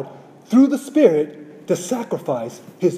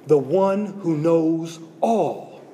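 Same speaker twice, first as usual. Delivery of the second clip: slow, softer, drawn-out words; slow and hushed; 4 semitones lower, 4 dB quieter, much slower than usual. Shown as a demonstration. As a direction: let it land slowly and quietly. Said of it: "repeated for emphasis".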